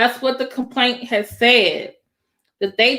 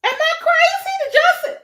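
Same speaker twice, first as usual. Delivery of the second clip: very high, incredulous squeal; high-pitched excitement. Real, not acted. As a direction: pitch shooting way up in disbelief. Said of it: "I get higher when I get excited".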